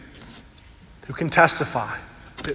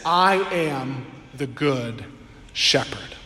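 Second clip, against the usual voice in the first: tender Jesus quote; 6 semitones lower, 4 dB quieter, slower than usual. Acted. Preacher voices Jesus quietly.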